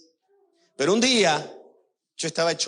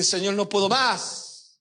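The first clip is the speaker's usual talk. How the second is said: slow, drawn-out retold plea, más falling long; crying plea; 6 semitones higher, much slower than usual. Pastor reliving his tearful cry to God, slow and drawn-out. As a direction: stretch each word out like a worn-out cry. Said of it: "Y lloré y dije".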